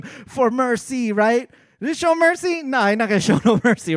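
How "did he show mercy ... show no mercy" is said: loud, fast and excited, half laughing; hyped-up movie talk. Retold or imitated. Talking up a John Wick revenge scene, excited and loud. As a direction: pump up the action movie payoff.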